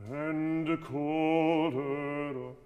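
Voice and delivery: slow and smooth "And called her up"; legato. Shown as a demonstration. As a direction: sing it long and connected. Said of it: "you can be more legato now".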